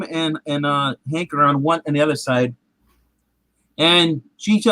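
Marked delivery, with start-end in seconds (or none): none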